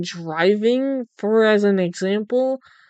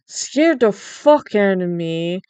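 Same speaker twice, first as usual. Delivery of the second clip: normal talking voice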